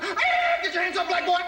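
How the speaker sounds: mock cop shouting at a kid; imitating a cop. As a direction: bark it like a cop in the alley. Cops stopping him.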